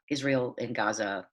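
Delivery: usual speaking voice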